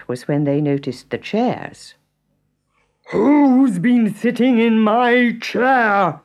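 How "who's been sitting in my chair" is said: lightly roared papa bear; Father Bear roaring. A narrator's reading. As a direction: boom it out like a huge papa bear. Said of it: "roared Father Bear".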